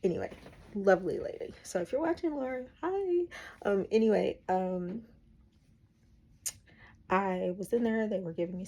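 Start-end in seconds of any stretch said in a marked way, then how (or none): 1.9-3.3 excited greeting. Real, not acted. Greeting a hospital roommate.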